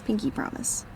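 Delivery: whispered pinky promise. Real, not acted. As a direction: breathe it out as a secret.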